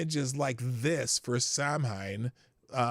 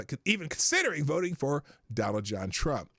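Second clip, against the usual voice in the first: ordinary talk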